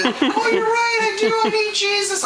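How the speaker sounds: high-pitched, hammed-up tearful agreement; mock sobbing. Sobbing customer agrees with preaching man, mock tearful wail. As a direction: imitate a weepy convert. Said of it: "but she's still sobbing and visibly shook up".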